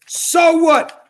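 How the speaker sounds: high-pitched, angry challenge; defiant outburst. Real, not acted. Man answering charges of hate, defiant outburst. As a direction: throw it back at your accusers.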